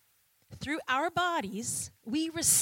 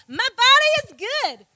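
loud, high call for the crowd to repeat; call and response; 10 semitones higher, 12 dB louder, faster than usual. Shown as a demonstration. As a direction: lead the room, calling out loud. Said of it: "Everyone say".

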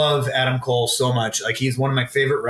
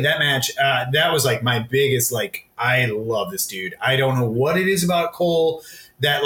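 normal talking voice

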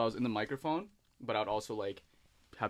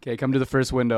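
loud clear instruction; drive-through cashier; 2 semitones higher, 14 dB louder than usual. Pretend. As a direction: call out to the car. Roleplay cashier.

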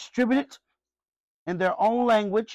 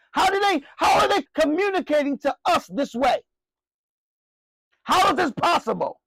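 higher, pleading wonder in their voices; amazed crowd; 7 semitones higher, 3 dB louder than usual. Acted. Preacher voicing the crowd amazed at their own tongue. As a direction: cry out in disbelief at the miracle.

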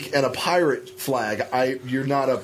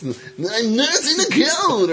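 very high, drawn-out mock sick voice; mocking put-on voice; 13 semitones higher, 4 dB louder than usual. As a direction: caricature a coworker's annoying voice. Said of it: "what's that voice?".

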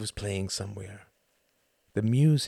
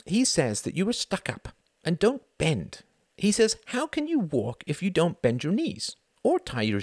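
put-on child reporting; 9 semitones higher than usual. A narrator's reading. Girl quoting dad.

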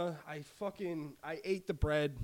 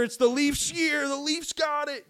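mocking Leafs fans; 10 semitones higher, 5 dB louder than usual. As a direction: parrot the fans' yearly hype with a sneer. Mocking hopeful Toronto fans.

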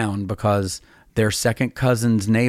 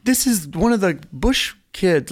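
bright, higher call to the tech beside her; excited introduction; 10 semitones higher than usual. Retold or imitated. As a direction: announce him with delight. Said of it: "tapped her guitar tech".

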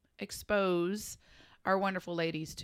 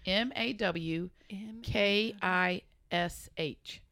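spelling it out slowly; much slower than usual. Shown as a demonstration.

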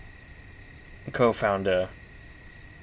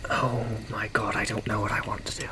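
whispered; 4 dB quieter than usual. Real, not acted. Player stuck, mutters to self.

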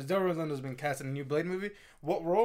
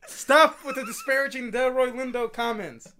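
mock tearful plea; 5 semitones higher, 7 dB louder, slower than usual. Mock defending actor, teary laugh.

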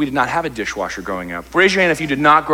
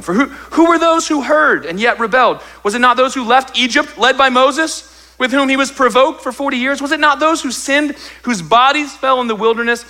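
much higher, louder preaching; preaching at full force; 11 semitones higher, 3 dB louder than usual. Acted. Pastor pressing the congregation, preaching at full force. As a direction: preach it with rising fire.

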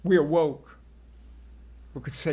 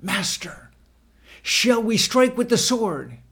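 urgent call to the master, building louder; raised-voice appeal amid an arrest; 5 semitones higher, 7 dB louder than usual. A narrator's reading. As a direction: call out, rising into a high, loud question. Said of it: "one of us called out".

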